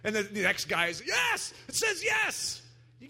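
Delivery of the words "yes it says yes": much higher, slow shouted yes; excited shout. Retold or imitated. Preacher voices the next guy slowly shouting his yes. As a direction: draw out the yes in glee.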